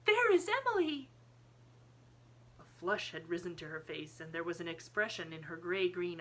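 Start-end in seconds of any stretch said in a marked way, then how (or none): 0.1-1.1 put-on very high, loud little-girl outcry; child's high-pitched exclamation to her father; excited cry. A narrator's reading. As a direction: cry out in thrilled discovery. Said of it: "she cried".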